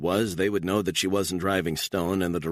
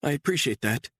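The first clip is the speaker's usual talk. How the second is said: a touch higher, subdued; lightly voiced woman; 10 semitones higher than usual. A narrator's reading. Woman telling her captor about her custody loss, lightly voiced.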